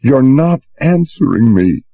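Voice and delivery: put-on girl pressing the bosun, mildly tearful; tearful accusation. A narrator's reading. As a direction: accuse him, a touch teary. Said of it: "she said in tearful accusation".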